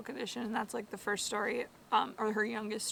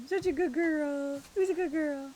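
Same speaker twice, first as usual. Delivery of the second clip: doggy voice; 6 semitones higher, 3 dB louder than usual. Real, not acted. Praising the dog.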